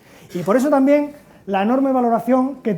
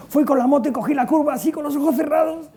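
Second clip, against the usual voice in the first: a higher, laughing brag about the reckless ride; thrilled boast; 6 semitones higher than usual. Retold or imitated. Teen friend bragging about riding blind, laughing excitedly. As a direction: brag it with a giggle, proud of the madness.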